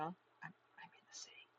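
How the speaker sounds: put-on shy girl whispering; timid whisper. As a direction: stammer it out in a soft whisper. Shy Hanako voiced by reader.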